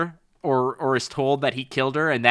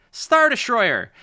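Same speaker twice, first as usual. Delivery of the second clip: announced card call; 10 semitones higher, slower than usual. Retold or imitated. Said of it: "play this card and say".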